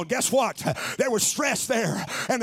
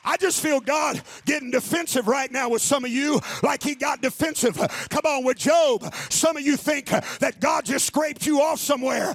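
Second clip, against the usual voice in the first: louder, higher, slowing on the big lines; full-force preaching; 4 semitones higher, 3 dB louder than usual. Acted. Pastor preaching to his church, full-force and rising. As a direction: preach it, building to the cry of God.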